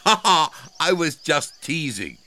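loud, high acted "ha ha"; laughing. A narrator's reading. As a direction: tease with a hearty mock laugh. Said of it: "laughed Elmer".

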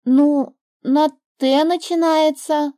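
put-on the girl explaining her hint; little girl explaining. A narrator's reading. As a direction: explain it like a child. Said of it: "пояснила девочка".

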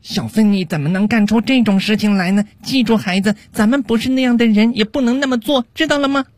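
put-on high-pitched mom lecturing her child; high, earnest motherly scolding. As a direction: admonish a little boy like his mother, pitch climbing to the end. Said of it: "妈妈说道".

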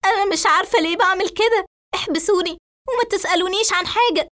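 high, tearful, hysterical outburst; acted nervous breakdown, pitched high; frantic agitation. A narrator's reading. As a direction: repeat it desperately, then rush on. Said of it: "وهي تشد وتقطع شعرها بأيديها بعصبية".

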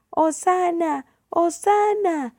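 high, ringing-out hosannas; crowd cheering. A narrator's reading. Crowd waves palms for Jesus, cheering. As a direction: call high, stretching the second cheer.

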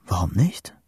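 hushed whisper with a rising end; whispered thought. A narrator's reading. Boy thinking to himself under storm clouds, whispered. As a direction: whisper it as a dawning question. Private thought, first take.

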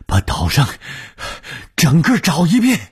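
high, loud desperate order; shouting. A narrator's reading. Ordering a search of the whole island, desperate shout. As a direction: shout the command, climbing to a high yell. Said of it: "红玉大叫着".

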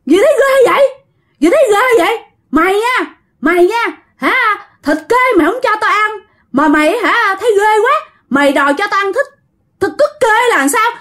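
very high-pitched, outraged spirit; possessed howling. Acted. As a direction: wail your complaint as a thwarted ghost. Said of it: "ông hai gào lên".

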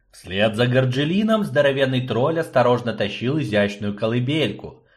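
ordinary delivery, nothing marked

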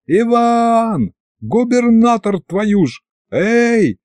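high calling yell across the square. A narrator's reading. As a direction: bellow for him down the steps. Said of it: "заорал".

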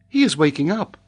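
put-on high girl's voice; young girl. A narrator's reading. Mary voiced in narration.

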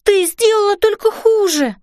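high-pitched dismayed outcry; exclaiming. A narrator's reading. Girl telling fairy mother it got worse, exclaiming. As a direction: protest in a high voice. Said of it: "воскликнула я".

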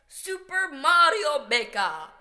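clumsy game-announcer accent on Super Mario Maker; bad accent. Shown as a demonstration. Announcing the game title, a bad accent. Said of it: "I said that in a very bad accent".

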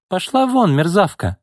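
very high, furious dismissal; exhaled outburst. A narrator's reading. Boss orders secretary out, furious. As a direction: throw her out with a high-pitched insult. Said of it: "выдохнула Изольда".

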